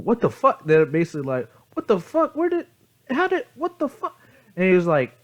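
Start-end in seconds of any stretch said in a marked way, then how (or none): 0.0-0.6 high-pitched, rising disbelief; mock shock. Staff finding the old silk is real, mock shocked. 1.7-4.2 very high, stammering disbelief; mock bewilderment. Staff faced with a time traveler, comically bewildered.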